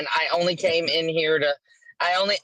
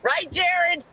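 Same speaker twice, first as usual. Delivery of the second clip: high, playful teasing; 9 semitones higher than usual. Acted. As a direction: tease him in a high sing-song.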